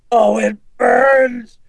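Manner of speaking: drawn-out demonic scream; guttural cry of pain. A narrator's reading. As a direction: scream as if scalded by holy water. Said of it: "Regan cried out gutturally".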